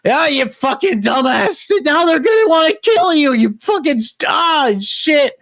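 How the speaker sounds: high, drawn-out frantic cursing; exasperated scolding. Acted. Scopper berating the boy who spoke up, exasperated. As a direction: scold in panicked disbelief. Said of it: "Scopper being like".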